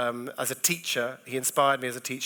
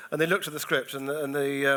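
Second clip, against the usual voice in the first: normal talking voice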